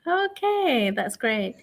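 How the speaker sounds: ordinary delivery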